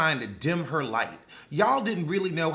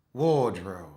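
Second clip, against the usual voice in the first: mocking echo; 7 semitones lower than usual. Real, not acted. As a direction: lower mocking echo of "Wardrobe". Mocking word repeated.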